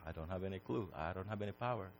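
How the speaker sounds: very soft, low, meek admission; humbled Job. Acted. Job answering God after being challenged, humbled and quiet. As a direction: answer small and barely voiced. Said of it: "And Job said".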